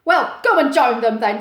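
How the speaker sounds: loud, grumpy brush-off. Acted. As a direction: shoo him off grumpily. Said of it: "pointing grumpily".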